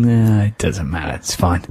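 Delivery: low, slow shrug of a voice; dismissive. Retold or imitated. Mock executive brushing off the poster, dismissive. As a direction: wave it away like a bored executive.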